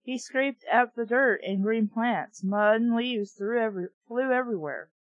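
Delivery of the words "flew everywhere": ordinary delivery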